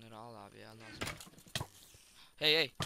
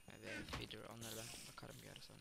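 ordinary talk, nothing marked

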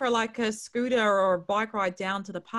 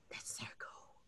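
whisper; 21 dB quieter, slower than usual. Real, not acted. Quiet aside while sharing screen.

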